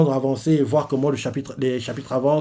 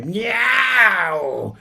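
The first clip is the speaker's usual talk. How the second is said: mock lion meow; 6 semitones higher than usual. Lion creature cries out.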